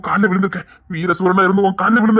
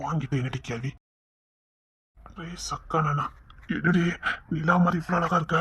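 soft romantic murmur to his wife; tender coaxing; 2 semitones lower, 10 dB quieter than usual. Acted. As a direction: sweet-talk her gently under the breath. Husband sweet-talking his wife.

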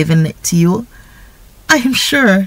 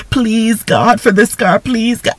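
lightly imitated pleading prayer; a touch of repetitive begging; mock begging; 4 semitones higher than usual. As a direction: run the pleases together like a nagging petitioner. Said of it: "it becomes like begging God".

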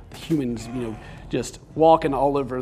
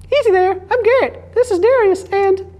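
much higher, louder squeaky voice; Garrett high-pitched voice; 9 dB louder than usual. Acted. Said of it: "I'll give Garrett a high-pitched voice".

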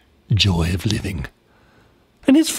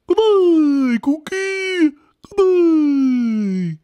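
chubby koala; much slower than usual. Acted. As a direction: call it out like a dopey koala. Clemmy answers Cookie.

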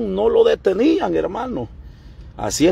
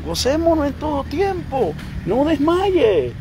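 rising preaching; 5 semitones higher than usual. Real, not acted.

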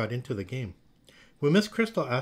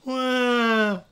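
high, loud, drawn-out "wah"; acted crybaby wail; child's wail; 11 semitones higher, 7 dB louder than usual. A narrator's reading. Mia falling down after twirling, acted wail. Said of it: "wailed Mia".

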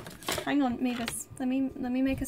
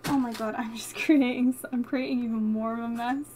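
ordinary talk

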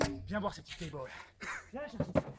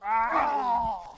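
ordinary talk

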